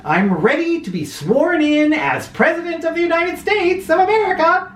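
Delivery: high, keen offer; eager vice president. Acted. Vice president offering himself, high and eager. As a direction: swell with self-importance.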